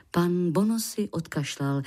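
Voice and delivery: ordinary delivery, nothing marked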